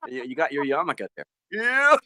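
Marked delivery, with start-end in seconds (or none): 1.5-2.0 high squeaky puppet yes. Acted.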